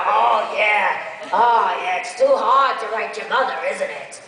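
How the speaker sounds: loud, mocking sarcastic drawl; loud sarcastic demo voice; sarcastic character. Shown as a demonstration. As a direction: needle them with sarcasm. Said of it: "different characters".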